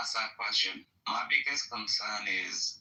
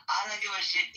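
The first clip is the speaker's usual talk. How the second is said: higher and louder, heated; a flash of real anger, louder and higher; heated outburst; 8 semitones higher, 4 dB louder than usual. Real, not acted. Heated rant on fuel prices.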